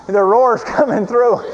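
usual speaking voice

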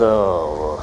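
drops to a low bored "Oh"; mock eye roll. Husband brushing off his wife, dismissive low sigh.

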